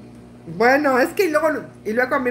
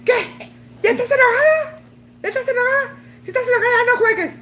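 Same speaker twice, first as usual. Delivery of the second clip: much higher mocking voice for the husband; teasing husband; 10 semitones higher, faster than usual. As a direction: needle her like a teasing husband, pitched up. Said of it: "me dice mi vieja". (esposo burlón con voz mucho más aguda)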